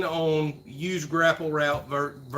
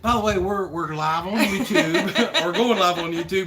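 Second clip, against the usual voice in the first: laughing; 3 semitones higher, 5 dB louder than usual. Retold or imitated. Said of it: "I'm like".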